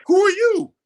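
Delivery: rising to a shouted preacher's challenge; preaching climax. Real, not acted.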